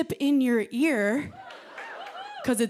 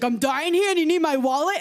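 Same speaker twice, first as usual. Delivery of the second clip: mock outraged yell; 6 semitones higher, 5 dB louder than usual. Husband indignant at wife.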